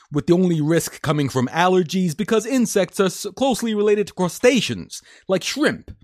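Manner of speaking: high-pitched New York man-on-the-street holler; New York accent. Acted. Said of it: "break out my New York accent. My shouting man on the street New York accent".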